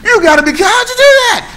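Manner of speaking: high, loud amazed disbelief; amazed onlooker. Retold or imitated. Amazed crowd at a magic trick, high loud disbelief. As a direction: marvel at a magic trick, voice leaping up. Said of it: "magic trick and they go".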